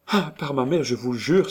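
high-pitched, impulsive oath; fervent vow cried out. A narrator's reading. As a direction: exclaim it as a heartfelt promise. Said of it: "s'écria Hélène". (serment exclamé, voix aiguë)

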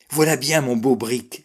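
cheerful boast, voice lifted; hearty exclamation. A narrator's reading. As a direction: exclaim heartily, admiring your own ship. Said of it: "s'écriait-il".

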